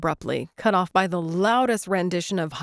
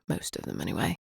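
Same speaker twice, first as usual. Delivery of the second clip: much lower, softer throwaway; low teasing aside; 6 dB quieter, faster than usual. A narrator's reading. Liam teasing Claire about Americans, low and dry.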